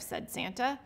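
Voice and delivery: ordinary delivery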